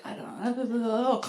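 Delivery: slow, garbled sleep-talk; imitated sleep mumble. Husband answering wife while sleepwalking, sleepy mumble. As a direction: slur it as if fast asleep.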